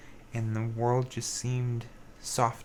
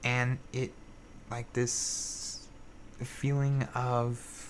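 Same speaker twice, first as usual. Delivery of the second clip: ordinary talk, nothing marked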